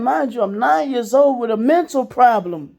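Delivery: ordinary talk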